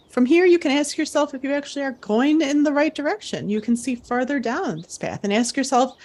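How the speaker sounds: camp counselor guiding an imagined climb; guided visualization. Acted. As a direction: lead the campers up the block, coaxing.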